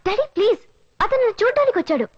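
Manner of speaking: begging dad to calm down. Acted. Daughter pleads with her drunk father, high and urgent.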